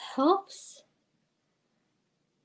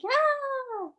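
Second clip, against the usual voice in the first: short high burst of a yell; happy scream; 12 semitones higher than usual. Shown as a demonstration. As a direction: shriek for joy on one breath. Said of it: "I like".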